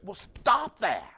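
high, loud, drawn-out "stop that"; mock-exasperated, punched and stretched out; blunt rebuke. Doctor answering a complaining patient, bluntly exasperated.

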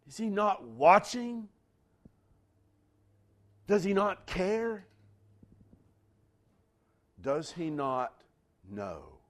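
high, loud, then fading to a hush on know; anguished questions. Acted. Preacher asking the congregation whether God cares, rising then hushed. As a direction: ask as if abandoned.

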